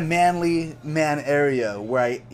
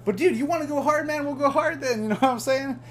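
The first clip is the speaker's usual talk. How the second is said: much higher, cocky challenge; hyped-up bravado; 10 semitones higher than usual. Real, not acted. Vlogger daring a rude opponent, cocky and excited. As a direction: throw down the dare, grinning. Bragging burst, pitch way up.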